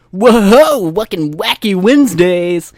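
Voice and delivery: high, very loud DJ whoop; wacky radio host. Acted. Podcaster mocking radio hosts, hammed-up DJ shout. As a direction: yell it like a zany morning-show jock. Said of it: "I couldn't be a radio host".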